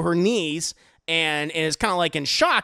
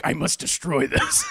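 mock villain; 3 semitones lower, 4 dB louder than usual. Mock villain line in a retelling.

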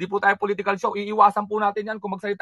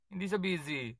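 much softer, slightly lower; quiet aside; 4 semitones lower, 14 dB quieter than usual. Real, not acted. Host insisting to himself that Bianca isn't busy, quiet aside. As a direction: drop it to a near mutter.

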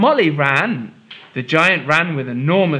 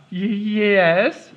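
nervous stammering king; 6 semitones higher than usual. Acted. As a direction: stammer out the answer. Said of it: "stammered the king".

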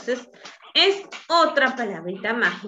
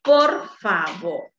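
"por favor" stretched slowly and a bit louder; slow emphatic demo; 4 semitones lower, 4 dB louder, much slower than usual. Shown as a demonstration. Teacher naming the magic word to children, slow and clear. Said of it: "una palabrita mágica que es la palabra".

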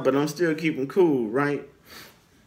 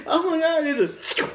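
retold alarm; 12 semitones higher than usual. Acts his own alarm retold.

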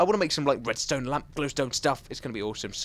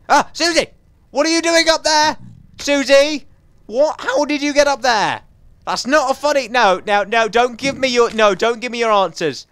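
high, loud telling-off; exasperated scolding; 8 semitones higher, 8 dB louder than usual. Real, not acted. Gamer loudly scolds his pet Susie for escaping.

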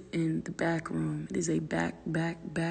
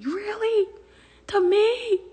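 much higher, louder indignant question; mock indignant; 6 dB louder, slower than usual. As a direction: act offended at the dog. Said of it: "I'm having a whole conversation".

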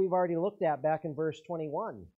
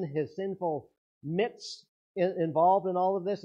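louder, pressing, insistent sermon voice; preaching at full force; 4 semitones higher, 6 dB louder than usual. Acted. Preacher urging the congregation, loud and insistent. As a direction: drive the point home to the congregation. Preacher stresses God's sovereignty.

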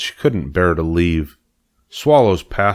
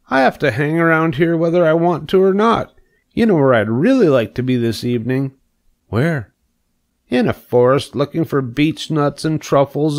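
put-on higher, eager young pig voice; dreamy pig longing for the forest, voice raised; young pig Wilbur; 8 semitones higher than usual. Pig telling spider his dream of the forest, higher eager voice.

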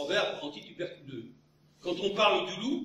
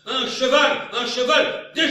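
loud, high, laughing cry of un cheval; mock royal cry; 8 semitones higher, 13 dB louder, slower than usual. Comic pun sketch, loud laughing cry. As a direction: bellow it out with a laugh. Mock shouting a horse call.

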